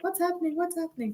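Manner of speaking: high-pitched little-girl imitation, kept soft; imitating young daughter. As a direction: play a worried kid asking, high and quiet. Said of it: "my, my daughter ... so she is like".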